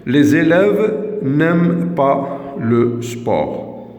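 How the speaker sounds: very slow, each word set apart for pupils; slow dictation. Shown as a demonstration. Teacher reading the negative sentence slowly to pupils. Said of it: "الجملة النافية".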